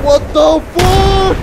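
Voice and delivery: slow, drawn-out, high yell; furious shout. Real, not acted. Gamer yells at getting shot in the match. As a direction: roars it out in disbelief. Ambushed in game, outraged.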